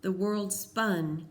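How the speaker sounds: ordinary delivery, nothing marked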